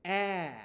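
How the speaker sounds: a short open ah, held; short a sound. Shown as a demonstration. Teacher modeling the short a sound. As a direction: sound out ah for the class. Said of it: "What letter makes this sound?".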